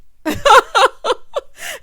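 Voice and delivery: high, loud cackle; loud laugh. Real, not acted. Reviewer cracking up over the prison call, laughing loudly. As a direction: burst out laughing at full volume.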